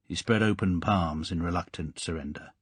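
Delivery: plain reading voice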